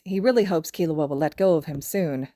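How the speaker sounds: ordinary delivery, nothing marked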